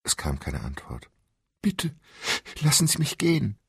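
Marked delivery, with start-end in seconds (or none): 1.6-3.6 high, louder pleading; desperate pleading. Acted. Captive woman begs the stranger to let her go. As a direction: beg for your life.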